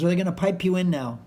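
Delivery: loud mock promoter; promoter asking. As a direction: call it out over a noisy lobby. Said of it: "He goes".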